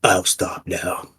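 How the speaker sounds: imitating Bastion. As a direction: try to sound cool like him. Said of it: "he does your voice".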